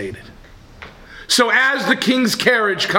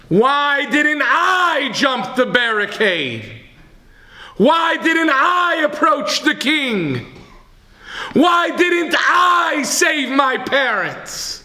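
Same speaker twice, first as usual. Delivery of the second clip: anguished cry; 9 semitones higher, slower than usual. Retold or imitated. Old man on BBC radio crying out his regret, anguished. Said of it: "And he continued to cry".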